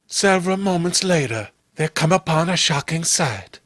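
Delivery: ordinary delivery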